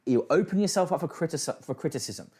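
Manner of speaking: ordinary talk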